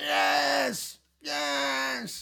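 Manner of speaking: high strained cry of yes yes; mock submission cry. Mocking a wrestler tapping out with a high cry. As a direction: wail it like a beaten wrestler giving up.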